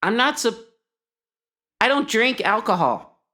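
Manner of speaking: high, loud, scandalised denial; alarmed protest. Acted. Monk told the stars were alcohol, alarmed loud protest. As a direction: cry out in shocked protest.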